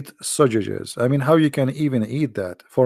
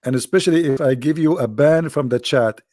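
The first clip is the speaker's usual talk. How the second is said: ordinary delivery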